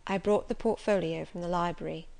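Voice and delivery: ordinary delivery, nothing marked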